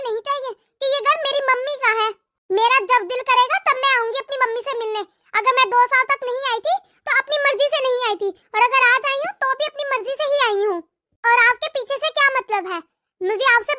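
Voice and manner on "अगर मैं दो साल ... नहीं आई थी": normal talking voice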